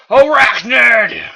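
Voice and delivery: slow loud commanding shout; yell. Acted. Megatron summoning his soldier, loud slow bellow. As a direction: bellow the name across the field. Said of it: "He yelled".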